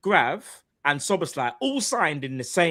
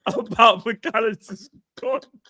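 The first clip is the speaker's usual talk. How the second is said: laughing, higher mock accent on the contract news; mock Spanish accent; 7 semitones higher than usual. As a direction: keep the Spanish reporter voice while cracking up. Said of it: "You're still Spanish".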